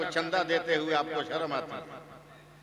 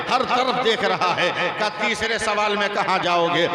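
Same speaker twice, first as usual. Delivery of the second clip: louder, building fervent climax; preaching at full force; 6 dB louder than usual. Acted. Boy answers the debaters with fervent preaching. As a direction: drive the point home with rising fervor.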